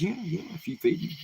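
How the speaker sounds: very high, eager little snake voice; high squeaky snake agreeing. Acted. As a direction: chirp it back quickly like a small snake. Snake answers hunter.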